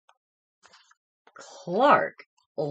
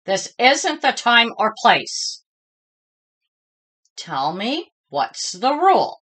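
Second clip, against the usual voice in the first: a touch of firmness, louder; lightly stern teacher; 4 semitones higher, 5 dB louder than usual. A narrator's reading. Teacher reminding Clark of the rule, lightly firm. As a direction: remind him firmly. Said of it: "said Missus Inky Dink".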